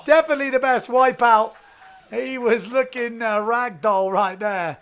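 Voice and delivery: loud live call, easing down towards the end; excited wipeout commentary. Real, not acted. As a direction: still fired up from the crash.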